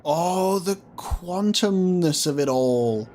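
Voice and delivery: high, slow awestruck musing; awed. Real, not acted. Gamer marvels at the ending, slow awe. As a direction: marvel at it, drawn out.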